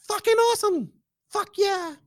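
thrilled yelp over the film; excited. Real, not acted. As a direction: rave about it, high and thrilled. Raving about the early screening.

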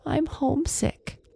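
high sad whisper; homesick whisper. Acted. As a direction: whisper it, missing home. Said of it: "she told him".

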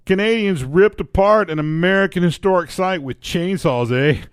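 put-on Canadian accent. Breaking news to cohost, in a Canadian accent. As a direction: read it as a cartoon Canadian. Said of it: "you're not using the accent".

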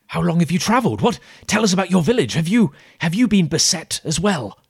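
eager islander. Acted. Islander pleading with the strangers for news, eager. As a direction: press them eagerly for news.

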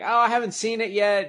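high quick self-quote. Retold or imitated. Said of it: "I was like".

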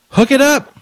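high, loud cheer; mock hype. Prank caller cheering the clerk on, hammed-up. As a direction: pump up the clerk.

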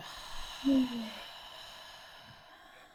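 drawn-out, airy release breath; long exhaling sigh. Shown as a demonstration. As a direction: let all the air out slowly.

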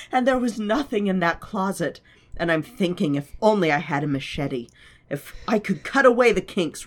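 acting out Lala's dream monologue; theatrical reading of a character; dramatic reading. Reads Lala's monologue aloud.

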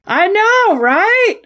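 very high, delighted fan squeal; gushing agreement. Real, not acted. Fan agreeing with co-host about dreamy accent, gushing.

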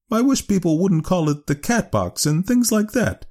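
very high woman's character voice; prim objection, pitched right up; Leora Duncan. Acted. Clerk objecting to the slang name, high disapproving voice.

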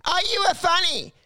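high, goading mimicry; mocking heckler's partner. Heckler's partner badgering a comedian, high mocking imitation. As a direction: imitate her pestering in a high voice. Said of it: "even his partner was like".

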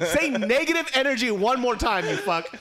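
high, loud laughing jab; laughing taunt. Real, not acted. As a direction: taunt him through laughter. Laughing tease of friend.

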